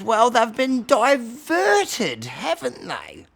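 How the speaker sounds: high, loud, slow elder voice; Bobby. Acted. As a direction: draw it out loudly, high and plaintive. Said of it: "And Bobby says".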